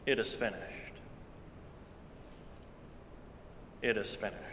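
a dying whisper of a cry; hushed last words. A narrator's reading. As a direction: let it fall away almost to nothing. Jesus cries out on cross.

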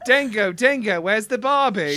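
hammed-up Australian with laughter in it; mock Australian accent. Keeping the Barbie riff going, hammed-up Australian accent. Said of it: "Australian accent".

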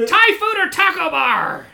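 high, loud kobold demand; kobold figure. Acted. Kobold quizzes visitors about food, high loud squawk. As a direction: squeal it like a frantic little kobold. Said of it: "He says".

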